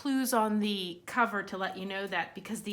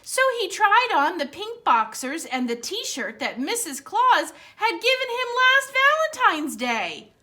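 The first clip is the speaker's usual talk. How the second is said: lively storybook read-aloud; reading to children; 4 semitones higher, 6 dB louder than usual. Acted. Librarian reads Santa's Underwear aloud to children, animated. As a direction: read it brightly for the kids at story time.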